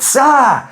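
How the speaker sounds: startled high yelp of pain; yelp at a hot dish. Retold or imitated. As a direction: yelp as if a hot dish burns your hands. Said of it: "You'd be like".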